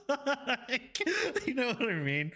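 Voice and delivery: high, laugh-filled words. Real, not acted. Self-confessed nerd appealing to listeners, laughing. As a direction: talk through the giggles. Laughing at own nerdy remark.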